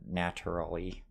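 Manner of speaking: "naturally" as NAT-er-uh-lee; rejected pronunciation. Shown as a demonstration. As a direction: say "naturally" with a hard T, softly. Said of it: "I would not say".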